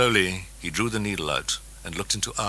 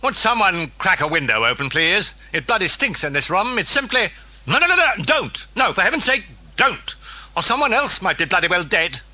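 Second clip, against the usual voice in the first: higher, louder, prissy British disdain; disgusted Dennings; 13 semitones higher, 7 dB louder than usual. A narrator's reading. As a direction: complain with distaste. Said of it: "now grimaced with distaste".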